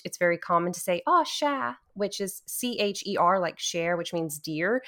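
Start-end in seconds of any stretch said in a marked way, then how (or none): 1.1-1.7 high, cooing Cajun endearment; demonstrated fond "aw", pitched up; cute reaction. Shown as a demonstration. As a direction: coo it as if seeing something adorable. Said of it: "whenever you see something very cute".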